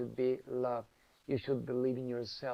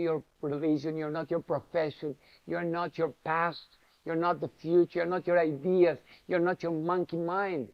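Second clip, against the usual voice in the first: ordinary delivery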